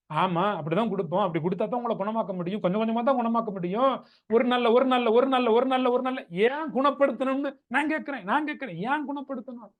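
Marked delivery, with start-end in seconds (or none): 4.3-9.7 high, insistent repeated questioning; worked-up rhetorical demand; exasperated challenge. Acted. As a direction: hammer the repeated phrase, pitched up. Challenges demand for one-day cure.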